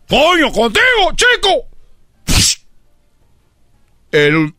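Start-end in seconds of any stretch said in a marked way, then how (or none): 0.1-1.7 very high, slow curse; hammed-up exasperation; exasperated father. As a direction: curse the boy in frustration.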